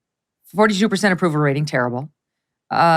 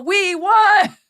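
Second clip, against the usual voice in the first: high triumphant gloating; mock triumphant. Newspaper headline gloating over the balloon, mock triumph. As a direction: crow the victory. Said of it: "Like the newspaper article".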